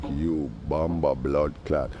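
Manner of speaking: low, slow, quiet mock insult. Muttering at the neighbor's door, slow, low and mocking. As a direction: drag it out low and dismissive. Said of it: "I'm like".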